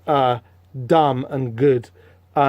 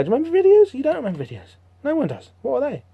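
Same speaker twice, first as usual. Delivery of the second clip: mock incredulous; 9 semitones higher, faster than usual. Real, not acted. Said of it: "joking about videos".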